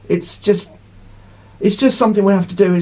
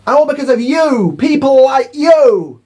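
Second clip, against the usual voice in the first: drawn-out, louder and higher accusation, slowing at the end; mock accusing shop owner; 5 semitones higher, 4 dB louder than usual. Shop owner blames the leaver customer, slow accusing voice. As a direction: jab the blame at him slowly.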